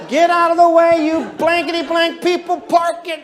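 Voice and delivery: high, loud angry driver shouting; hammed-up freeway rage; road rage yell.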